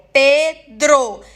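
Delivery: Pedro very slowly as Pe... dro; syllable by syllable. Shown as a demonstration. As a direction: break Pedro into two separate beats. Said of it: "Tem duas sílabas". (Pedro em duas sílabas, bem devagar)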